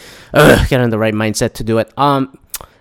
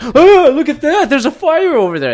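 mock alarm; 13 semitones higher, 4 dB louder than usual. Mocking outrage seekers, hammed-up higher alarmed cry. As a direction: play a gawker pointing at a fake fire. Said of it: "they go like".

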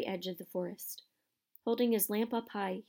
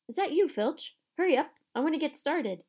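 higher, louder impatient call; distant shout; 7 semitones higher, 5 dB louder than usual. Acted. Groundskeeper hails the caretaker, shouting impatiently. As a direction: call out to hurry someone along. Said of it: "they heard a distant shout".

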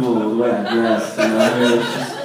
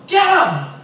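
high-pitched holler from a bystander; mock yell; 3 dB louder than usual. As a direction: imitate someone yelling across a distance. Acted distant people calling out.

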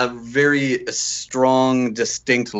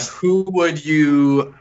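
ordinary delivery

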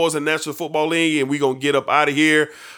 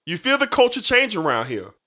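ordinary delivery

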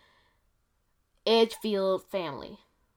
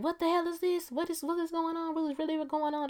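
high-pitched panicky questions; mock frightened disbelief; alarmed disbelief; 9 semitones higher, faster than usual. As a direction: voice the family's shock, pitched up. Family scared by risen vampires.

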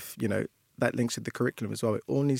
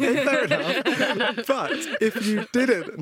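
laughing; 13 semitones higher, 6 dB louder, slower than usual. Real, not acted. Laughing at child's Jamaica answer.

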